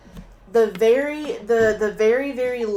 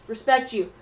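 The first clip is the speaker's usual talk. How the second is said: ordinary delivery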